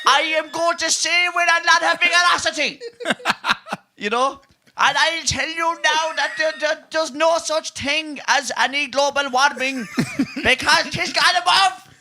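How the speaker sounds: a hammed-up Kerry TD ranting with passion; Healy-Rae impression. Mocking the Healy-Raes' speech in the Dáil, fierce Kerry accent. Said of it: "They say".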